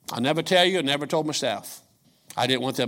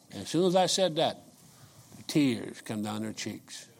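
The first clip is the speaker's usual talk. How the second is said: usual speaking voice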